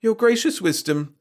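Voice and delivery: high, hesitant address; faltering. A narrator's reading. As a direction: falter before the king. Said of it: "faltered Wirt".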